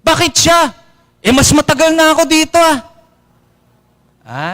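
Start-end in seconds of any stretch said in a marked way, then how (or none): 0.1-2.8 high whiny mock complaint; high-pitched jealous grumble; envious parishioner. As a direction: sulk about Father's favourite.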